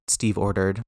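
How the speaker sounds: usual speaking voice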